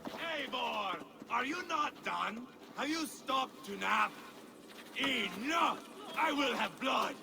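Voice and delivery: losing patience, he roars for blood; impatient angry calls. Acted. Ivar yelling for Eivor to hurry, furious.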